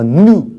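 "nu" opening on a nasal N; nasal N sound. Shown as a demonstration. Teacher shows students the nose sound in "nu", nasal N. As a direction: say "nu" with N through the nose. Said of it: "是鼻子的音，nu是".

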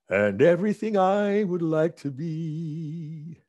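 put-on slow, heartfelt address to his kids; slow, tender, half-sung tribute. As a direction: speak it like a song lyric to loved ones. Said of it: "I'd probably say".

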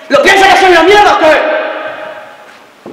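high, drawn-out yell; explode, stretching the question into a slow roar; furious shout. Acted. Boyfriend demanding if she despises him, furious shout. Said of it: "hablándome de esta manera".

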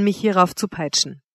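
plain reading voice

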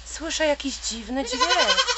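a tiny bird waking its friend in alarm; little bird, worried. Acted. Little bird urgently waking the sleeping deer, high and worried.

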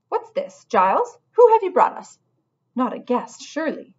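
high, loud, startled porcupine cook; shocked to see a stranger in her kitchen; surprised cook. Acted. Cook spotting the mouse, high and surprised.

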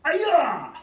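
loud sharp fighting yell; repeated karate yell on command; kiai shout. Shown as a demonstration. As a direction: bark the kiai out hard. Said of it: "knee up and yell".

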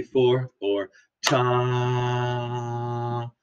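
very slow, ta stretched long and soft; held ta. Shown as a demonstration. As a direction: hold the ta for four beats. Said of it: "one way we can count whole notes".

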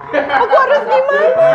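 high, loud helpless wail; hammed-up panic. Vlogger panics as the doll comes alive, hammed-up. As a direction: wail it in mock alarm, stretching the end.

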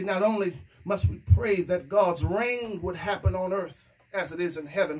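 normal talking voice

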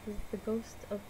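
quiet, deadpan mock radio host; low, flat, sleepy host delivery; monotone voice. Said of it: "like, monotone voice".

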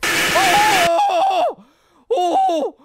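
loud high startled cry; startled shout. Real, not acted. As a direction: yell as if jump-scared. Jump scare in game.

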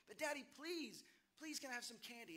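put-on high, eager kid voice pleading for candy; begging little boy. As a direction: play a five-year-old tugging at dad. Child begging a third time.

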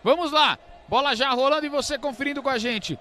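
brisk futsal play-by-play; live play-by-play. Real, not acted. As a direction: call the action as it starts. Play-by-play kicks in. (narração esportiva ao vivo)

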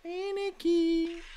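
very high, drawn-out call to the cat; sing-song call. Real, not acted. (richiamo cantilenato acuto)

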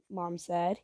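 plain reading voice

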